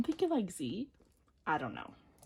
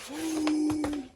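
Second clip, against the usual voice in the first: sharp gasp; 8 semitones higher, 4 dB louder than usual. Real, not acted. Opening the first poster.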